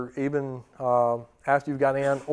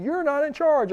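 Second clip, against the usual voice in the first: raised pitch, laying down the law; stern authority; 11 semitones higher than usual. Acted. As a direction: lecture a cocky recruit. Drill instructor laying down rules to recruits.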